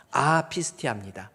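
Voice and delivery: ordinary talk, nothing marked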